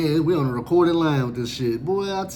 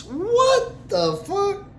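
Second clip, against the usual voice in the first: very high, drawn-out "what the fuck"; stunned disbelief; 14 semitones higher, much slower than usual. Real, not acted. Inmate hearing he is on suicide watch, stunned. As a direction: stretch it out in total shock.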